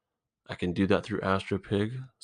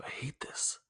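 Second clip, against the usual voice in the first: real whisper, much softer; whispered to himself; 15 dB quieter, slower than usual. Real, not acted. Talking to his listeners about his indecision, a real whisper. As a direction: breathe it out under your breath. Quiet self-reproach alone.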